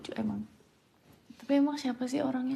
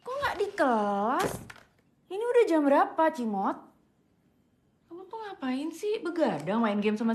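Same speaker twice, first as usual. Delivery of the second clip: loud, high scolding; scolding mother; 4 semitones higher, 5 dB louder than usual. Acted. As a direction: tell her off, sharp and loud. Mother scolding late child.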